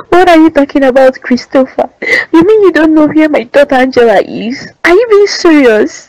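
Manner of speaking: high, loud, disbelieving cries at him; distraught yelling. Acted. Mother screaming at husband over missing daughter. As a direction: yell at him through tears. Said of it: "Stop yelling at me like that".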